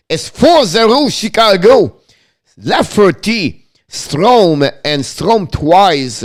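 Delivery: milk the big score; excited announcement. Acted.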